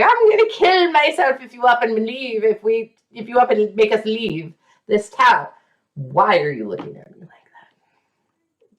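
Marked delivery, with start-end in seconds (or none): 0.0-5.5 a melodramatic French wife's threat, pitched higher and louder; dramatic French wife. Retold or imitated. Wife threatening her husband over moving, melodramatic and higher. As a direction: play it as an overwrought French heroine. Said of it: "'Cause it's just so French".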